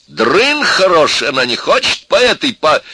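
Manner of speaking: loud, high angry rant; exploding in anger. A narrator's reading. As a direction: rage on until cut off. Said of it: "взорвался Максимыч".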